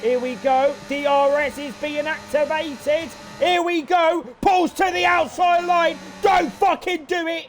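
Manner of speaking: building to a shout, then pleading; frantic race call. Real, not acted. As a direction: yell the move as it happens. DRS move on final lap.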